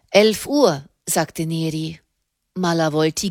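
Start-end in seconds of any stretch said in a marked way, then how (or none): none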